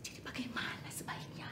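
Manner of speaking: hushed urgent whisper; nervous whispered question. Acted. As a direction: ask it in a barely audible whisper. Conspirators fear being overheard.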